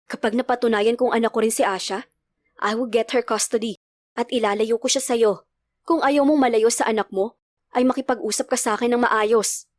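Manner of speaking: plain reading voice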